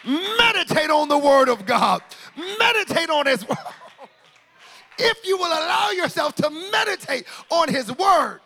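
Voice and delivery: loud sermon cadence, musical backing; full-force preaching. Acted. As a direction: preach it with rising fervour.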